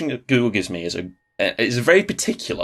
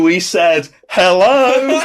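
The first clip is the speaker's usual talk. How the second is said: cave echo; 6 semitones higher, 5 dB louder, much slower than usual. Pretend. Mock cave echo reply.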